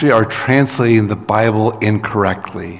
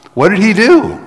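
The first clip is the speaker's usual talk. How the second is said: rhetorical question; 12 semitones higher, 5 dB louder than usual. Real, not acted.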